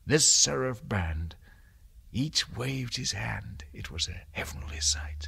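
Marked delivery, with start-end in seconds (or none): 3.7-5.3 hushed whisper of wonder. A narrator's reading. Narrator whispering of the angels' light. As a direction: breathe it like a secret. Poem recital, whispered line.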